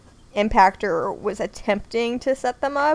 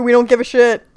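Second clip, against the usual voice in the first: loud dismissal; 2 semitones higher, 9 dB louder than usual. Retold or imitated.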